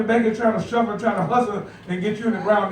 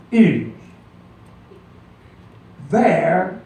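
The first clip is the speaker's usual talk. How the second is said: scripture reading; 3 dB louder, slower than usual. Acted. Reads scripture aloud.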